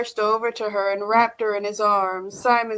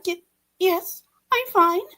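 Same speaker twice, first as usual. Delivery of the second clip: higher, shaky and faltering; 8 semitones higher than usual. A narrator's reading. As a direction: force out a shaky denial. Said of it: "In a shaky voice".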